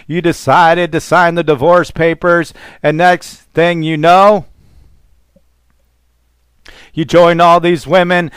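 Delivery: ordinary talk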